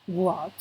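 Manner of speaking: low, isolated teaching example of WHAT; model pronunciation. Shown as a demonstration. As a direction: say WHAT carefully for learners to hear. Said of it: "Listen again".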